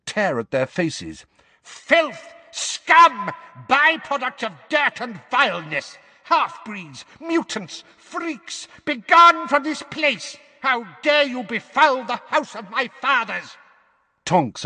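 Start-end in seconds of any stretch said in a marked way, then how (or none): 1.6-13.6 very high screamed tirade; screeching old woman. A narrator's reading. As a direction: screech curses like a deranged hag. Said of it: "she screeched louder than ever".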